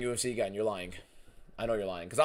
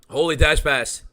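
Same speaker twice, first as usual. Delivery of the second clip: louder, higher cry of delight; excited shout; 6 semitones higher, 10 dB louder, slower than usual. Real, not acted. Streamer to chat, shouting with delight. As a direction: yell it out like a jackpot win. Repeat, louder, different timbre.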